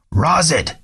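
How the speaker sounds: strained high screech of a name; hoarse screech. A narrator's reading. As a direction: try to yell, it comes out a screech. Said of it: "This time he tried to yell".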